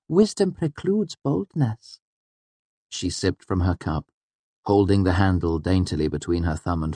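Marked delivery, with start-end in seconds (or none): 0.1-2.0 put-on very high, slow girl character; high-pitched, measured little sage; sententious Auri. A narrator's reading. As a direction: pronounce the maxim slowly in a high girlish voice. Auri's character voice states a maxim.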